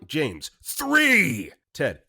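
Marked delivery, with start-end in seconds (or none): 0.6-1.5 much higher, louder final count; mock menacing yell; countdown shout. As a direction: bellow the last number. James ends the countdown.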